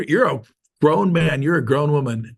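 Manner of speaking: normal talking voice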